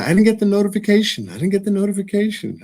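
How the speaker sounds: high, fast, whiny complaint; viewers complaining. Retold or imitated. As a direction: mimic viewers whining about notifications. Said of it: "just a couple of people say".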